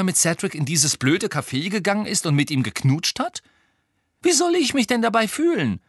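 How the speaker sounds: high, loud, rising outrage to a near shout; Harry protests to Hermine, building to a shout; indignant. Acted. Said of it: "sagte Harry empört". (empört, immer lauter bis zum Aufschrei)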